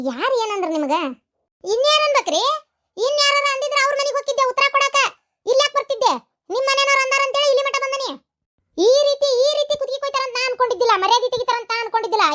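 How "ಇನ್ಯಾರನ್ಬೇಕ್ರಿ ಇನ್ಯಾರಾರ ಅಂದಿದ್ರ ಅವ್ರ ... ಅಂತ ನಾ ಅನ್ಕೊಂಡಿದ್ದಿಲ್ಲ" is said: raised, higher angry complaint, hurt pride; furious outburst. Acted. Insulted woman confronting the household, furious and raised. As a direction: lash out at the insult, pitch climbing.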